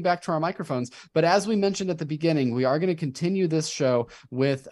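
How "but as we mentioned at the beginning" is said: normal talking voice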